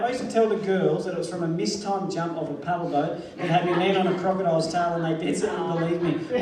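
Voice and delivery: usual speaking voice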